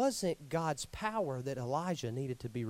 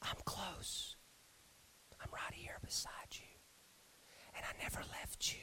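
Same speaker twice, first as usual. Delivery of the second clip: tender, softer whisper as God; 17 dB quieter than usual. Acted. God reassuring Elijah in the cave, tender whisper. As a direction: whisper it like a promise close by.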